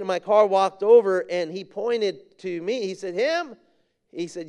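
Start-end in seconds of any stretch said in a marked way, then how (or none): 3.1-3.6 questioning. Retold or imitated. Young man questions who is meant.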